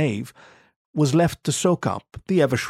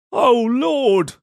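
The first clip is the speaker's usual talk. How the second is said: high, louder desperate plea; pleading cry; 13 semitones higher, 4 dB louder than usual. A narrator's reading. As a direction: cry out to God, voice breaking. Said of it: "I pleaded".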